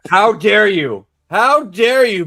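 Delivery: theatrical how-dare-you outrage; mock outrage. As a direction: play offended in jest, loud. Mock outrage defending Billy Zane.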